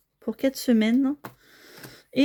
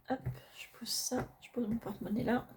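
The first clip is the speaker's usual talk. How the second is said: soft unvoiced whisper, a bit quick; 10 dB quieter than usual. Real, not acted. Budget vlogger to her viewers while setting down the wallet, whispering. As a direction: breathe the words without voice as you set things down.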